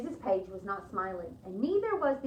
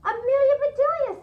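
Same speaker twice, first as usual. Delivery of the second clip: much higher, loud happy call; excited greeting; 11 dB louder than usual. Acted. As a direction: call her name with delight. Missus Page greets her.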